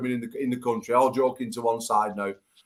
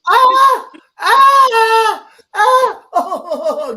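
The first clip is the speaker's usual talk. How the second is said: helpless high-pitched laughter, much louder; high squealing laugh; 11 dB louder, much slower than usual. Real, not acted. Host shrieking with laughter at the women's joke.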